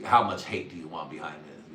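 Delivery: normal talking voice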